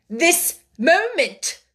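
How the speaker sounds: slow, high, hammered-out order; fierce, deliberate command. A narrator's reading. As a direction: bite out each word slowly. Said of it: "was the fierce answer".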